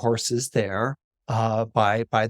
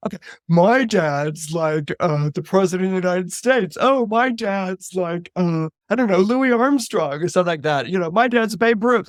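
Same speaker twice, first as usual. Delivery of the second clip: sarcastic schoolmate, pitched up; mocking parody; 5 semitones higher, 3 dB louder than usual. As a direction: jeer with made-up brags. Schoolmates parody the boy's boast.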